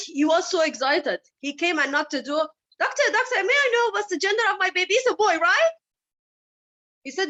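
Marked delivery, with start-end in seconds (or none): 2.8-5.7 high, fast, eager questioning; eager husband. Retold or imitated. Husband pressing the doctor about the baby, high and fast. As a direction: pester the doctor excitedly.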